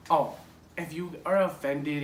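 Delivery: ordinary talk, nothing marked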